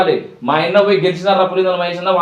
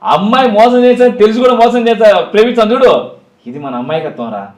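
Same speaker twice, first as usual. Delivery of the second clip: louder, higher dramatic hero speech with heavy pauses; film dialogue delivery; 3 semitones higher, 6 dB louder than usual. Acted. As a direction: declaim it like a mass-movie punchline. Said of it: "అదే స్టైల్లో చెప్పాలి మంచిగా".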